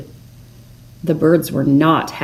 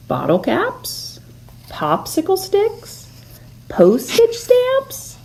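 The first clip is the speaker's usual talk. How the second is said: high squirrel voices; 12 semitones higher, slower than usual. A narrator's reading. Squirrels guessing gifts.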